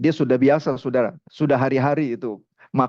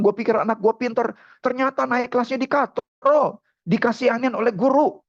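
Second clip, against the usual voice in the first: high-pitched indignant complaint; acted dad venting about his son; worked-up father; 8 semitones higher than usual. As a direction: grumble in a raised pitch, mildly angry. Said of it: "Ini karena papanya emosi".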